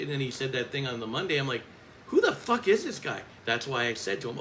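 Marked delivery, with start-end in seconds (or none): 2.1-3.2 high-pitched incredulous outburst; exasperated disbelief. Retold or imitated. Coach retells her reaction to colleague's taunts, exasperated. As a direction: burst out as if you can't believe him.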